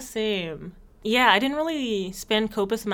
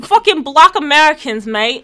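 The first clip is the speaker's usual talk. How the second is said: loud, higher mock London rant; mock British ranting, louder and higher; mock British accent; 6 semitones higher, 11 dB louder than usual. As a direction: rant like a cocky South Londoner.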